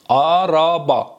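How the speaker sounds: slow "آرابا", every a long and open; careful Turkish pronunciation. Shown as a demonstration. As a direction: stretch "آرابا" syllable by syllable. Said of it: "تلفظ درست برید". («آرابا» کشیده و شمرده به ترکی)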